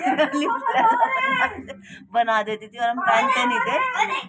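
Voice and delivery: high, slow, cracking up while quoting; giggling mock chant, pitch way up; laughing. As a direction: laugh through the childhood taunt. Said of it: "तो हम कहते".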